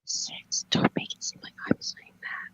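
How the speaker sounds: breathy ASMR whisper. Shown as a demonstration. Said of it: "talk like this".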